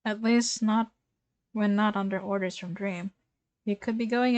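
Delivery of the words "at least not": usual speaking voice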